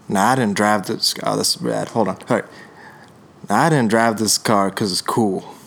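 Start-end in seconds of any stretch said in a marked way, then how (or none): 0.1-1.1 slow, lower actor impression, lightly voiced; a hint of a slow, easygoing film star; Matthew McConaughey. First try at an actor for guessing friends, slow impression. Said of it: "try to talk like these people". 3.5-5.5 slow actor impression, pitched a little down; Matthew McConaughey. Impressionist voices an actor for guessing friends, slow musing drawl. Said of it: "Is it Matthew McConaughey?".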